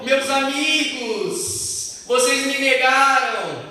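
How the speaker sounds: high, drawn-out reproach; mock accusing cry. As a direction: cry out the accusation, stretching the words. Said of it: "Ele gritou". (grito de acusação, agudo e arrastado)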